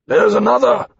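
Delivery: high call across empty air; faint distant call. A narrator's reading. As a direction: call out clearly from afar. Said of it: "his voice faint but clear across".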